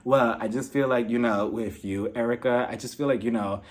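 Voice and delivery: very low, soft, hesitant impression; Crystal. Crystal tells Erica what she would do, low and subdued. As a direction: drop pitch and volume into a laid-back mock voice. Voicing Crystal jumping into the argument.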